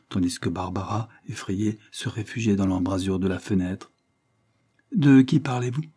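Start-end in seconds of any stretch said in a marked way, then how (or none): none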